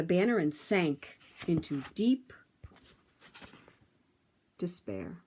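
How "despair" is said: ordinary delivery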